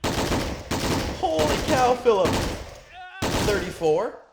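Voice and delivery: high, rising cheers for each kill; excited cheering. Real, not acted.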